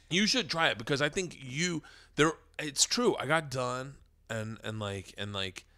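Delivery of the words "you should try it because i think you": normal talking voice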